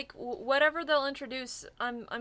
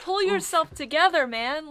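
higher, louder mock telling-off; mock exasperated scolding; 4 semitones higher, 6 dB louder than usual. Podcaster telling off a fictional character for losing control, mock exasperated. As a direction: scold him half-laughing, in exasperation.

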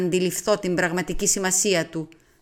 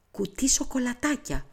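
softer, breathed under the breath; whispered; 5 semitones higher, 6 dB quieter than usual. A narrator's reading. Narrator teasing Poirot, softly whispered. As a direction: murmur it half to yourself. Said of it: "ψιθύρισα".

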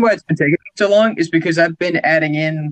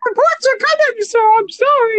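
much higher, nervous office underling; nervous employee voice. Acted. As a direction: squeak it out like a scared clerk. Timid employee in office improv.

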